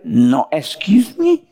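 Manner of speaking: slow, snooty put-on voice; mock stuck-up reply. Preacher mocks a believer turned proud, slow snooty voice. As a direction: drag it out like someone too proud. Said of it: "y usted va a no decir".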